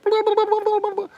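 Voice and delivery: very high squeaky blah blah; mocking squeaky blather. The kid still whining to the cop, very high mocking squeak. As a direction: squeak out nonsense like a whiny kid. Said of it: "It says, like".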